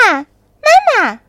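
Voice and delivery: put-on high, loud childlike calls; shouting repeatedly. A narrator's reading. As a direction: shout it high, one call after another. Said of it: "连声大喊".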